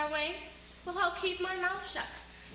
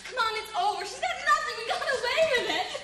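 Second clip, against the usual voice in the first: higher and louder, giddy with triumph; gleeful gloating; 8 semitones higher, 6 dB louder than usual. Acted. Woman urging her nervous lover, giddy and gloating. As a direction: crow about getting away with it.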